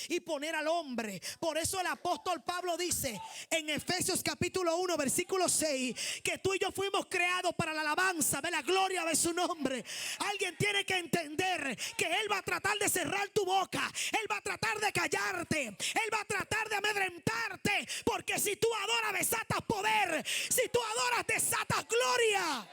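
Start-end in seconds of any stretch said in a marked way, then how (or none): 1.4-13.9 loud, driving sermon delivery; full-force preaching. Acted. Preacher raises intensity. 14.1-15.7 louder, higher preaching warning; loud preached warning. Acted. 15.7-22.7 loud, higher shouted preaching, slowing at the end; full-force preaching. Acted.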